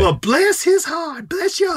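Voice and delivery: high, slow, syrupy mock-sweet drawl; sugary passive-aggressive. Coworker mocking supervisor in high, slow, sugary voice. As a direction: sing it up high and slow, all fake sweetness. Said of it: "in a very negative way".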